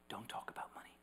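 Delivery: unvoiced whisper, hushed; whisper, first take. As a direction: breathe it like a secret warning. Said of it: "was whispered in my ear".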